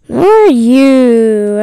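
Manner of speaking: slow, drawn-out creepy call. Acted. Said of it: "some creepy voice just talking".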